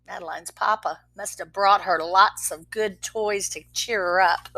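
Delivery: ordinary delivery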